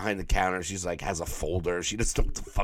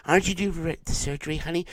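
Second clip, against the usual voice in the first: put-on girlfriend asking; 7 semitones higher, faster than usual. Girlfriend asks boyfriend.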